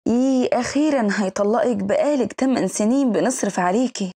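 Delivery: usual speaking voice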